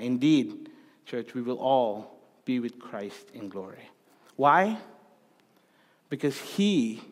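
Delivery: normal talking voice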